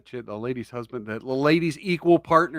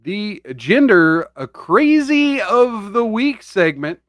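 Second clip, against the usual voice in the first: announcer voice; 8 semitones higher, 6 dB louder, slower than usual. Acted. Announcer segment intro.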